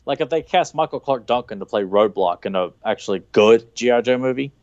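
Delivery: usual speaking voice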